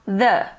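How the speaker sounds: "the" as unstressed-style "thuh", not "thee"; THE before a consonant. Shown as a demonstration. English teacher showing "the" before consonant sounds, pronounced "thuh". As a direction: say "the" with a short neutral schwa, "thuh". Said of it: "o som de consoante, nós vamos pronunciar".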